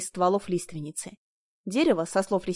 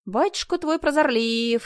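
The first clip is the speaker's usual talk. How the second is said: sarcastic; 5 semitones higher, slower than usual. A narrator's reading. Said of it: "съязвила я".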